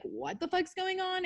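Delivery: high, startled, baffled outburst; bewildered mind voice. Retold or imitated. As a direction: play the panicked inner voice. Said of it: "your mind is like".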